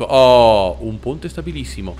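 low, very drawn-out word; sarcastic dismay. Real, not acted. Player facing a rickety bridge, sarcastic dismay. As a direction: stretch it right out, dreading what comes.

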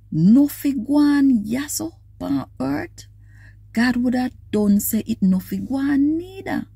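ordinary talk, nothing marked

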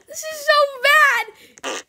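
high, slow, drawn out through laughter; laughing groan. Real, not acted. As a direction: wail it while laughing. Reacting to a fart.

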